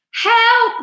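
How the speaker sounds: high, loud panicked cry; cry for help. A narrator's reading. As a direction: scream for help as they fall. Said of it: "cried the centipede".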